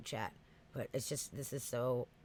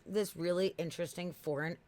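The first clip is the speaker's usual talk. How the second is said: ordinary talk, nothing marked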